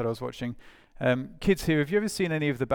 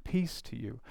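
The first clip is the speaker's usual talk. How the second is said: soft, slightly lowered, calming greeting; hushed; 3 semitones lower, 10 dB quieter, slower than usual. Acted. Jesus greeting the gathered disciples, hushed. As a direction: say it softly, to settle them.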